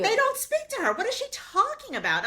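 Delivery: high incredulous outburst, half laughing. Real, not acted. Friend mocking Tamara's claims, voice shooting up in disbelief. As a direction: pitch it way up in disbelief.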